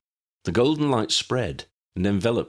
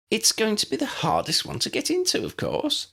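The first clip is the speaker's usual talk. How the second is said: lightly voiced Lydia. A narrator's reading. Lydia voiced high throughout.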